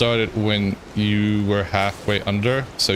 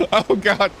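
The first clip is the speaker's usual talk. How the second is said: laughing; 10 semitones higher than usual. Real, not acted. Laughing at ship crash.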